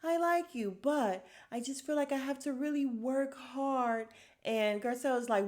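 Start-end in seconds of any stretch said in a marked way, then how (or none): 0.0-4.1 high-pitched mock sincerity; sugary high mimicry; mocking imitation. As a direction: imitate her in a high, put-on voice. Mocking replay of Diana's quote.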